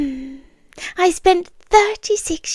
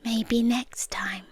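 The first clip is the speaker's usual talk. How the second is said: soft whisper; 9 dB quieter, slower than usual. Retold or imitated. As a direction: breathe it, barely voiced and slow. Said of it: "And you said".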